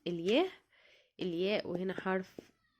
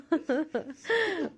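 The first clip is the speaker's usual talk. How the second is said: amused laugh; 7 semitones higher than usual. Real, not acted. Mom laughing at homework misery, laughing. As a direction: burst of real laughter. Laughing at her own complaint.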